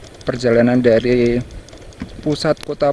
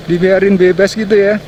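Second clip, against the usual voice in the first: surprised laugh; 6 semitones higher than usual. Real, not acted. Surprised by goats roaming free.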